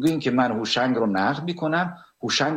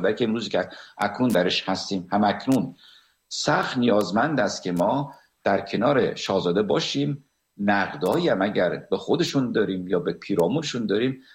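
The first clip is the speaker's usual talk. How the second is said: ordinary talk, nothing marked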